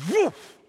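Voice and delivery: sudden loud, high-pitched "woof"; imitated whoosh. Preacher shows people rushing the altar, explosive whoosh. Imitates crowd jumping forward instantly.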